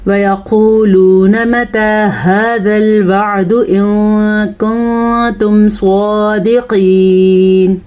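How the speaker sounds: slow, measured tajweed recitation; Quranic recitation. Shown as a demonstration. Teacher reciting a verse for students, slow measured tajweed. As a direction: recite with long, sustained vowels.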